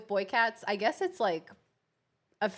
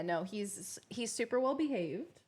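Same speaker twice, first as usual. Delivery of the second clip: ordinary talk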